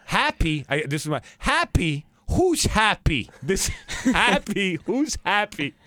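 mock the very idea of being happy; scoffing mom. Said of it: "she goes".

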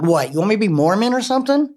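retold angry outburst. A guest retelling how she yelled at God on the road, rising in mock anger. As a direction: fire the question back, half-laughing at yourself. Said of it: "I'm walking down the road and I'm angry".

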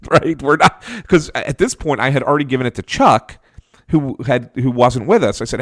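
ordinary talk, nothing marked

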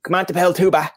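playful Newfoundland French with the tag "ba". Retold or imitated. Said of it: "in Newfoundland, it's probably like".

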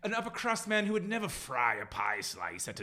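put-on upper-crust British accent, lightly done; a touch of posh British accent. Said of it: "I'm better at doing a posh British accent".

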